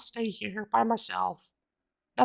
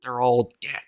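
dark deadpan; 10 semitones lower than usual. Acted.

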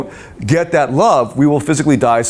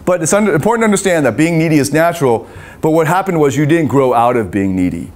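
normal talking voice

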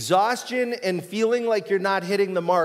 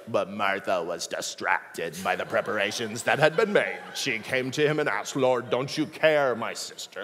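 creepy Bible narrator; 7 semitones lower than usual. Retold or imitated. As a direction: read it like a spooky Silence of the Lambs narrator. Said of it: "listening to the guy speak in the N I V is like listening to Silence of the Lambs".

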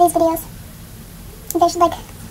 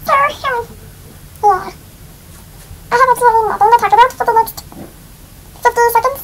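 high squeaky chipmunk voice; 5 semitones higher, 4 dB louder than usual. Pretend. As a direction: pitch it up like a chipmunk. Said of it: "do the chipmunk voice until I end this video".

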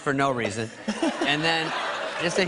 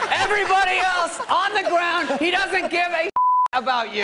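much higher, laughing drill-sergeant yell; shouted order; 6 dB louder than usual. Retold or imitated. Brother orders the pledges down, shouting and laughing. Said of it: "And he goes".

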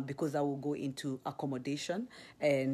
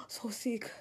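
mock shocked gasp; 8 semitones higher, 4 dB quieter than usual. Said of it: "I remember looking at everybody like".

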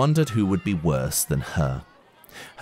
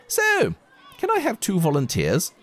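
much higher, fluttery wittering voice; wittering teacher; 12 semitones higher than usual. Acted. Teacher wittering to the class for volunteers, high. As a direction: twitter it out like a dithery teacher. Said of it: "wittered Miss Honey".

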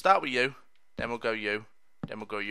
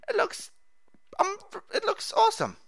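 squeaky delight. Pretend. Squealing about floor.

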